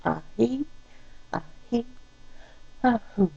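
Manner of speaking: soft, breathy in-and-out to calm herself; audible calming breaths. Real, not acted. Wife calming herself in the car, audible soft breaths. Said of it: "where you can hear me breathe".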